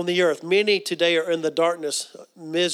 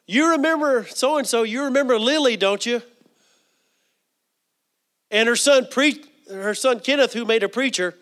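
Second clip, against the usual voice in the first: raised, louder voice as if to someone hard to reach; speaking up; 6 semitones higher, 4 dB louder than usual. Retold or imitated. Jogging dying aunt's memory, speaking up loudly. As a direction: project each name to get through.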